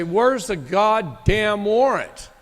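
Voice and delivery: retold outburst at the police, slow and loud; angry demand. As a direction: hammer out each word of the demand, pissed off. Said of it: "I'm pissed".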